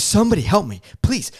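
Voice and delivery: high-pitched urgent cry for help; intense plea. Retold or imitated. Passenger begging anyone at the gate for help, intense. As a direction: retell a desperate plea, pitch jumping up. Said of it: "I was getting so intense".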